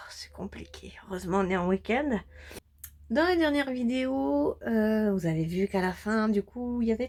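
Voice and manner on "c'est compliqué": breathy tired whisper. Real, not acted. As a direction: whisper it, worn out by a headache. Headache, sighs out a remark.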